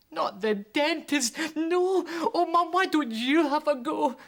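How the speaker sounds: put-on very high, loud childish begging; shouted pleading. Child begging mum not to take him to the dentist. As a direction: wail and beg like a scared kid. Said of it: "I shouted".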